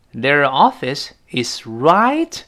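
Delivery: slow, drawn out reading; slow motion. Shown as a demonstration. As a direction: read it out word by word slowly. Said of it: "repeated slowly".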